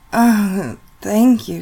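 sudden loud, high gasp of thanks; breathy gasp. Acted. Gasps thanks during the scene.

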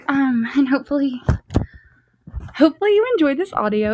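ordinary talk, nothing marked

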